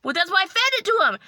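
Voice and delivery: high, loud, quick protest; exasperated retort. Retold or imitated. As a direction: blurt it out as an unspoken comeback. Indignant retort she only thought.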